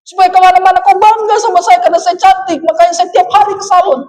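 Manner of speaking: high-pitched, protesting self-justification; indignant wife. Retold or imitated. Wife justifying her salon visits to husband, mock indignant. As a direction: play a hammed-up wife defending herself, voice high. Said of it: "perempuan kan kalau dimarahkan hatinya".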